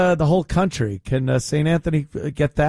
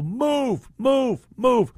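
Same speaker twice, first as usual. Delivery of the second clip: mock police command; 7 semitones higher, much slower than usual. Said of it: "step forward and go".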